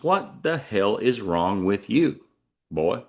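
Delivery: "What the hell is" slow, each word separate; spelled-out translation. Shown as a demonstration. Translating Texan for outsiders, "What the hell is" slowly spelled out. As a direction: space out "What the hell is wrong with you" deliberately. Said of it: "what that means is".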